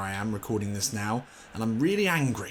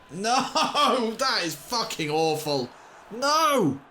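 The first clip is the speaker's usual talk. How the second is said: high, loud no with bitter laughter; reliving the conceded goal, shouting; despairing shout; 11 semitones higher, 5 dB louder, much slower than usual. Real, not acted. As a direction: yell out, then laugh in disbelief.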